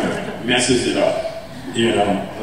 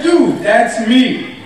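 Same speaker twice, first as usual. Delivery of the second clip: slow exasperated insistence; 9 semitones higher, 3 dB louder, slower than usual. Retold or imitated.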